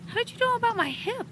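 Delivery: high, quick astonished ask; surprised question. A narrator's reading. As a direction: blurt it out amazed.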